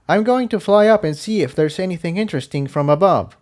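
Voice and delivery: a pony keen to take off; eager flyer. A narrator's reading. Tiptoe announces she will fly.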